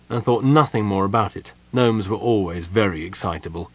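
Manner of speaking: ordinary delivery, nothing marked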